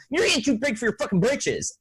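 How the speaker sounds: high-pitched mock taunt; tweaker impression. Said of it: "him go".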